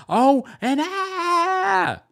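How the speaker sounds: high, drawn-out mock scream; mock yelling. Cast member going from zero to a thousand, mock yelling. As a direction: blow up into a long, exaggerated yell. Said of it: "why are we yelling?".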